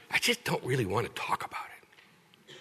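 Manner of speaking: whispering "talk about it" as a shy secret; mock whisper. Imitating a reluctant churchgoer, whispering the confession. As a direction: confess it under the breath, mockingly.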